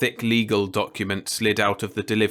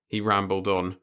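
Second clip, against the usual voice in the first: ordinary delivery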